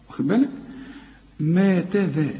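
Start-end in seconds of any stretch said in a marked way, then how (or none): 1.4-2.3 ما تذا thin and fronted, like "meh teh dheh"; light letters. Shown as a demonstration. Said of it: "رقق كل الحروف دي".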